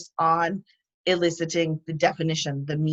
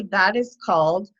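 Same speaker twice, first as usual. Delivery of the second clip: teacher demo; 3 semitones higher than usual. Shown as a demonstration. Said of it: "and you can say".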